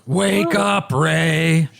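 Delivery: ghoul voice without yelling; a ghoul rasping his name; ghoulish voice. Acted. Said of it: "That's ghoulish".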